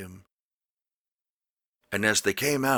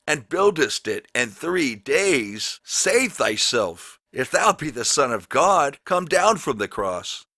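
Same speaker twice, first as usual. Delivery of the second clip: mocking taunt; 6 semitones higher, 5 dB louder than usual. A narrator's reading. Passersby wagging heads, jeering at the crucified man, loud scornful taunt. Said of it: "reviled him".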